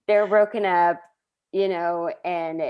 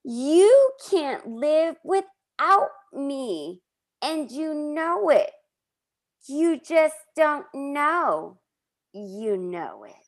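high, very slow dramatic Janice voice; Janice impression; 5 semitones higher, much slower than usual. Host performing Janice's foghorn speech, slow and high. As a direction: stretch every word like a soap diva. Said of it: "your Janice impression".

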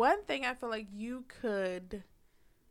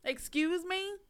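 loud, high indignant challenge; offended partner hitting back at the word; mock outraged; 6 semitones higher, 7 dB louder, slower than usual. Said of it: "That's like".

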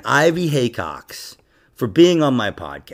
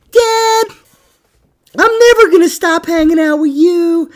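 put-on very high little-boy voice; little son; 5 dB louder than usual. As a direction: imitate a young son pledging to his dad. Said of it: "he would go".

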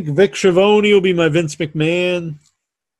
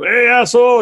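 higher calling-out voice; mock heckle; 6 semitones higher, 4 dB louder than usual. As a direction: hail someone rudely in the street. Bully calling out in story.

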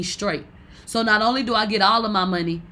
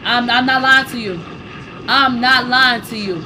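louder, pressing the point home; emphatic insistence; 2 semitones higher, 6 dB louder than usual. Real, not acted. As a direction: swear to it, loud and firm.

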